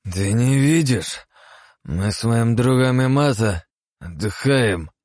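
put-on high, slow drunken whine; drunk slurring. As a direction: slur the words, tipsy and petulant. Drunk Osman keeps slurring.

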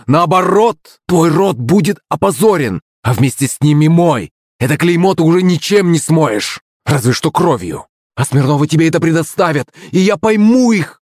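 high-pitched, heated reproach; angry tirade. A narrator's reading. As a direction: drive every accusation home indignantly. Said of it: "зарычала Клавдия".

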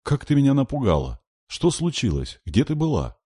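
high-pitched, urgent questions; a frightened big sister scolding in relief; alarmed scolding. A narrator's reading. Frightened sister scolds found child.

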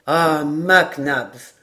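slow, stretched-out exclamation; exclaiming. A narrator's reading. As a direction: hail him with a long, drawn "Ah". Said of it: "s'écria Glenarvan".

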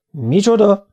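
high-rising indignant outburst; felbődülés. A narrator's reading. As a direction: burst out in disbelief. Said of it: "bődült el Kinizsi". (méltatlankodó felbődülés)

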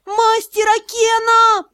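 high, slow full-throated yell. Acted. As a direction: yell his name with all your strength. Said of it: "изо всех сил заорала".

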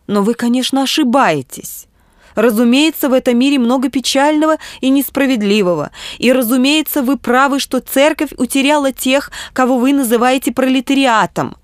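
high-pitched, conceding yet protesting bishop; earnest bishop. Acted. Bishop's reply in narration. (взволнованный епископ, убеждая)

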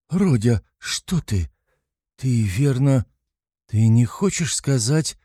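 high, halting, alarmed mother; a touch of alarm, hesitating between phrases; frightened. A narrator's reading. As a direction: break off in mild fright. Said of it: "начала была в испуге Пульхерия Александровна".

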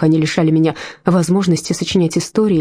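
ordinary delivery, nothing marked

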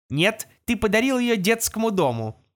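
computer navigator voice; gps voice. Acted. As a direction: talk like the van's navigator. Navigator answers Жеребкинс.

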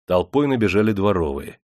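usual speaking voice

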